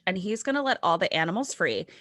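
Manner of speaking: ordinary talk, nothing marked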